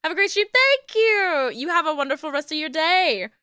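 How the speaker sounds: high sing-song farewell wishes; chirpy goodbye. Real, not acted. Streamer bidding the raider goodbye, high and chirpy.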